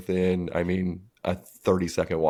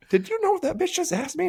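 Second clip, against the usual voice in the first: high, indignant question; outraged buddy. Retold or imitated. Buddy telling the speaker what she asked, high and outraged. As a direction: squeal the disbelief.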